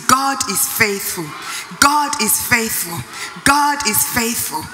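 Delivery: "God" hit firmly, each repeat a little stronger; declare it meaning it. Shown as a demonstration. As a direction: proclaim it like you believe it. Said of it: "Say it with me and mean it".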